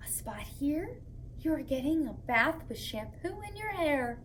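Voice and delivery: gentle storytime read-aloud; reading to children. Acted. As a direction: read it like a bedtime book to little kids. Reads picture book to children.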